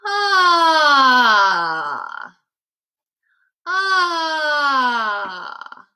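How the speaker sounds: a loud open "Ah" sighed out of the mouth; open sigh through the mouth. Shown as a demonstration. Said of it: "sigh all your air out of your mouth".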